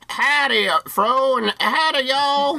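high, hammed-up Southern cowboy drawl; a tourist's idea of a Texan, way up high; American cowboy accent. Co-host greets the host as a cowboy, a high hammed-up Southern accent. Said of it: "Is that how Americans sound".

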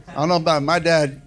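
normal talking voice

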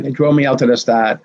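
usual speaking voice

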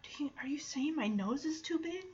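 hushed, worried whisper; a touch of offended whisper. Retold or imitated. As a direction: whisper it as if wounded. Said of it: "It's like".

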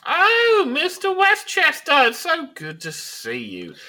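very high, loud, hammed-up lady's voice; gushing society lady. Lady Nigel greets Mister Westchester, high and gushing. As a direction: squeal the greeting grandly, pitched far up.

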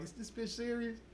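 high, soft retold aside; quietly incredulous to a friend; hushed disbelief. Boys privately remarking in the pool.

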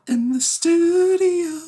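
very high, slow, drawn-out echo; squeaky high echo. Acted. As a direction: echo it back in a squeaky falsetto. Playful echo of host's question.

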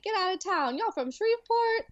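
lightly voiced Louisiana character, high. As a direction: quote Ray from the movie in a Louisiana accent. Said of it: "Ray is like".

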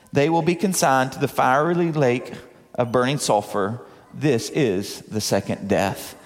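low, grave pronouncement; solemn scripture reading. Acted. Preacher reads the judgment verse to the congregation, solemnly.

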